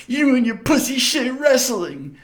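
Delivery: high-pitched tearful parting shot; hammed-up high, sore-loser wail; mock crying bully. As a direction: imitate a beaten bully whining in protest. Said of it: "he was literally crying".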